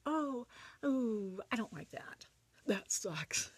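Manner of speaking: mock teenage distaste; typical teen. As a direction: play a put-off teenager, lightly. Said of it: "as a typical teen".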